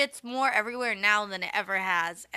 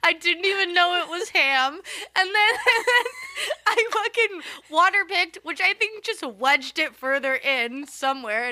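high, loud, laughing through the words; can barely hold back laughter; 8 semitones higher, 8 dB louder than usual. Real, not acted. Laughing through the ham story.

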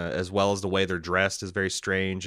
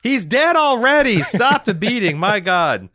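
much higher and louder, laughing mock protest; laughing mock plea; 12 semitones higher, 9 dB louder than usual. Laughing at the co-host piling on Schwartzman, a loud mock plea. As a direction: beg them to stop the pile-on, laughing.

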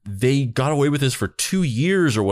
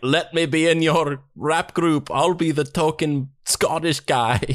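playful put-on Scottish accent, higher and slow; mock Scottish accent; 7 semitones higher than usual. Joining the rap group in a jokey Scottish accent. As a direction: hams up a Scottish accent for a joke. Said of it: "I'd break out my Scottish accent".

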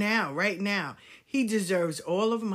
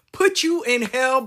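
usual speaking voice

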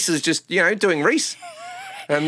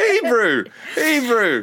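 higher, hammed-up New Zealand 'hey, bro'; New Zealand accent; 8 semitones higher, much slower than usual. Comic repeating his punchline, New Zealand accent. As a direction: call it out like a Kiwi greeting.

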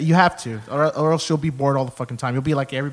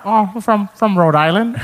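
raised, loud cartoon dad voice; Peter Griffin; 7 semitones higher, 4 dB louder than usual. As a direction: improvise as a goofy cartoon dad. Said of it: "doing that Peter Griffin impression".

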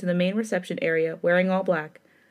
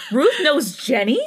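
slow, high, half laugh half cry; stunned disbelief cracking into a laugh; shocked; 5 semitones higher, 6 dB louder, slower than usual. Acted. Shocked heroine, voice breaking.

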